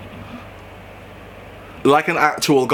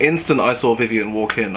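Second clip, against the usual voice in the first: normal talking voice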